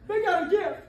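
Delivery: high, loud laughing yell; exasperated realtor yelling put the toilet back; laughing mock shout. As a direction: shout it through laughter, hammed up. Continues retold shouting at sellers.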